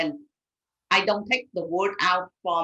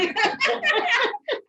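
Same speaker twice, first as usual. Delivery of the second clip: high burst of real laughter; bright laugh. Real, not acted. Laughing after ramen joke.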